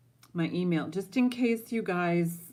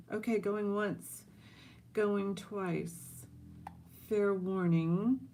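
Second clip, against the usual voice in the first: higher, slow sing-song countdown; auctioneer call; 4 semitones higher, slower than usual. Real, not acted.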